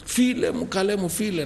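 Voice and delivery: high, pleading cries of "friend"; calling out to Jesus. Retold or imitated. Young man praying aloud at home, crying out. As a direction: cry out to your dear friend, voice lifting. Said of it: "να φωνάζω".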